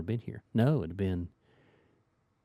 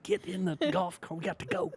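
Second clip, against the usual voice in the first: urgent hurry; 9 semitones higher, 6 dB quieter than usual. Retold or imitated. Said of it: "Like that".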